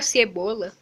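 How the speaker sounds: ordinary delivery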